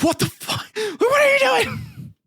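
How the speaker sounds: high-pitched mock panic; mock horrified outcry. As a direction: squeal it as a hammed-up horrified dad. Father catching mother burning baby.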